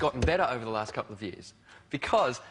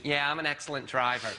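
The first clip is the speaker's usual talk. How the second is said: Rain Man impression. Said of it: "like Rain Man".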